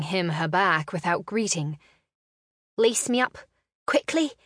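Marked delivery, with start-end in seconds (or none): 2.8-4.3 urgent breathy plea, higher and louder; rushed, breathless request; breathed. A narrator's reading. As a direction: breathe it out in a hurry. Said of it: "she breathed".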